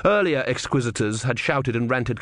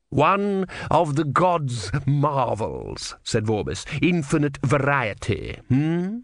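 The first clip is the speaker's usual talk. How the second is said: menacing inquisitor; 4 semitones higher, slower than usual. Acted. Vorbis slow, probing.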